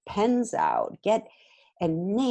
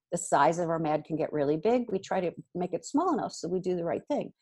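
ordinary delivery

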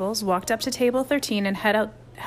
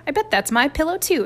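accusing; 7 semitones higher, 4 dB louder than usual. A narrator's reading. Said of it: "accused Harold".

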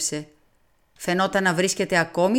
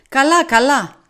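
louder, higher impatient brush-off; detective brushing off his friend, loud and impatient; 7 semitones higher, 9 dB louder than usual. A narrator's reading. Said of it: "είπε ανυπόμονα ο Πουαρό".

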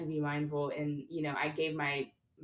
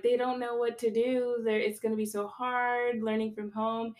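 voicing fretting adults, raised pitch; mock panic; 6 semitones higher than usual. Adults worrying about younger kids learning from home, mock panic. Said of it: "I felt like the adults were panicking".

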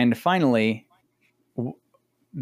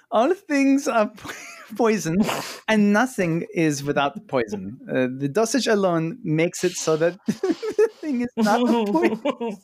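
lightly put-on Swiss German accent, higher; 7 semitones higher than usual. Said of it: "read it with a Swiss accent".

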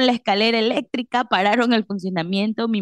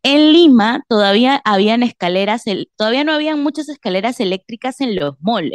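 ordinary delivery

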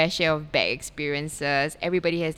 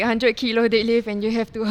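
usual speaking voice

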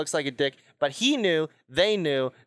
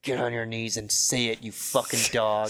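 angry boss; 7 semitones lower than usual. Retold or imitated. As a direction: demand submission, low and cold. Boss threatening employee.

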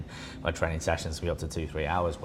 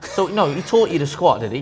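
sarcastic challenge; 7 semitones higher, 8 dB louder than usual. Retold or imitated. Challenging the lifter's claim.

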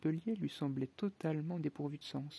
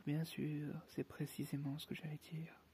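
softer, fading to a whisper; sleepy mumble; 7 dB quieter than usual. Acted. The Dormouse half asleep answering the Hatter, fading whisper. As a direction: drift back to sleep as you say it.

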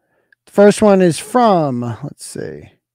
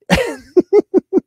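high-pitched burst of laughter; laughing; 12 semitones higher than usual. Real, not acted. Host cracking up after reading a parody donation, high laugh. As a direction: break up laughing.

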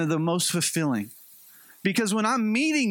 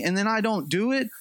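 ordinary talk

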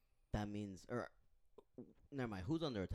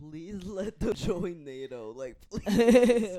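laughing plea; 9 semitones higher, 11 dB louder, much slower than usual. Real, not acted. Begging while laughing.

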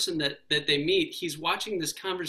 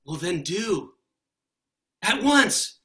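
higher, louder, imploring; urgent plea; 8 semitones higher, 6 dB louder than usual. Acted. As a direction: beg him to just ask. Solid person urges the ghost.

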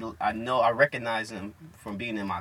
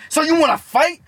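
high, loud confrontational shout; hammed-up yelled provocation; mock fight challenge; 10 dB louder than usual. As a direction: challenge him like a worked-up schoolkid. Imitates classmate's challenge.

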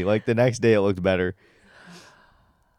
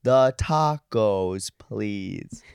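mom talking to foreigners; 4 semitones higher, much slower than usual. Retold or imitated. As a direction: stretch every word like a mom ordering abroad. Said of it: "like your mom talks to people who she thinks are foreign".

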